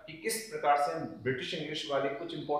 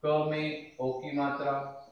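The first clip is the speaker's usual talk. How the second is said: ordinary talk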